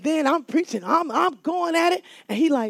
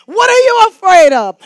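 loud demanding cry, much louder and higher; preaching shout; 5 semitones higher, 11 dB louder than usual. Acted. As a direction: shout the question at the church.

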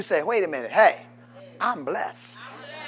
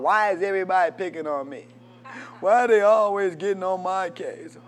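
whiny victim voice; 5 dB louder than usual. Retold or imitated. Preacher mocking a self-pitying victim, whiny. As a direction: whine like everyone's against you.

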